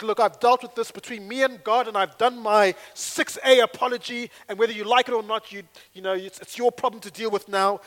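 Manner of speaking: loud, dismissive and smug; self-righteous. Shown as a demonstration. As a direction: brush them off while apologising. Acted self-righteous apology speech.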